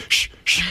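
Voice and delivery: imitated sharp shush; unvoiced hush to silence someone. Acting out a guest shushing his girlfriend, mock shushing. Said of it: "he would shush her every time".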